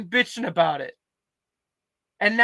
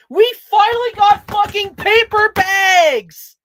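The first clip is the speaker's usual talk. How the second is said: high, loud, slow victory yell; triumphant shout; 14 semitones higher, 11 dB louder, slower than usual. Real, not acted. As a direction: belt it out in triumph. Triumphant shout about paper bags.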